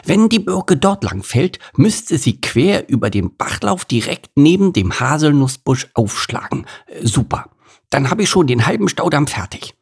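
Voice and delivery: put-on a young beaver working out his dam plan; storybook beaver musing aloud; beaver character voice. A narrator's reading. Bert the beaver thinking aloud.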